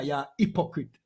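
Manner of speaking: usual speaking voice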